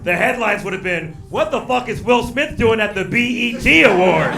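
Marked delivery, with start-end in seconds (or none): 1.3-4.4 loud, high, incredulous exclamation; mock outrage. Comic voices a baffled headline to the crowd, mock outrage. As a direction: cry it out in disbelief.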